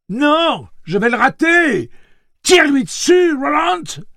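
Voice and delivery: very high, loud panicked refusal; cri affolé. A narrator's reading. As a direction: scream it back, pleading for him to shoot. Terrified refusal shouted back. (refus crié, très aigu, affolé)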